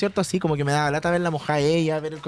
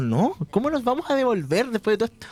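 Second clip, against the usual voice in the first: higher-pitched, incredulous objection; retold frustrated protest; 7 semitones higher than usual. Answering friends who suggest going back, frustrated protest. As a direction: protest in disbelief, as if retelling an argument. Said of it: "lo que más me frustraba".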